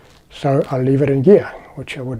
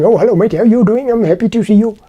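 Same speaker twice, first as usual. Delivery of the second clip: put-on high, lilting Indian accent, louder; 7 semitones higher, 4 dB louder than usual. Imagined Indian man greeting the newcomer, Indian accent. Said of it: "expecting this, this Indian accent".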